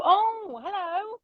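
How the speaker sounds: high, chirpy mock-friendly neighbour; nosy campers. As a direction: play the curious camper greeting the newcomer. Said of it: "along come the people go".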